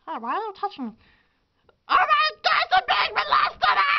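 high, loud mock panic; panicked shriek. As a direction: shriek in hammy alarm. Acted panicked shriek skit.

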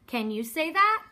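usual speaking voice

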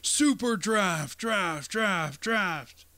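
slow booming echo on draft; announcer echo fading out; monster truck ad. Pretend. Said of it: "the monster truck voice".

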